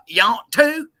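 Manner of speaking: high, slow, loud mock country drawl; imitating country accent. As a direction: stretch it out in a teasing country twang. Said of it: "likes my country hick voice".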